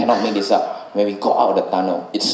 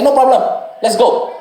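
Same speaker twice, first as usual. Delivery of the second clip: eager driver; 13 semitones higher than usual. Retold or imitated. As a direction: chirp it high and cheerful, ready to ride on. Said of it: "And he was like".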